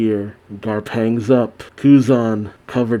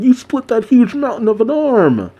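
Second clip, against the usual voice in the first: high-pitched imitation of a giddy fan; hammed-up squeal of amazement; marking out; 11 semitones higher than usual. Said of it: "Helmeppo's still marking out".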